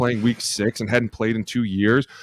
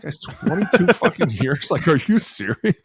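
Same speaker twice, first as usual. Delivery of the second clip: laughing disbelief; 7 semitones higher, 3 dB louder than usual. Retold or imitated. Retells incredulous reaction to Newman.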